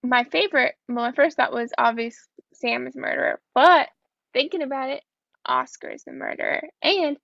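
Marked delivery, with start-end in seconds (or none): 5.4-6.7 whispered dramatic reveal; hushed horror-story reveal; spooky whisper. Pretend. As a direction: drop to a whisper for the twist. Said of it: "spooky horror speculation".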